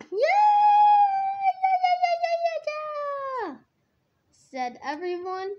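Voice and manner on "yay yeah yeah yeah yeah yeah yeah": very high, loud squealed cheer; excited cheering. A narrator's reading. Everyone cheers for an adventure, squealing excitedly. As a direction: squeal like a whole excited crowd.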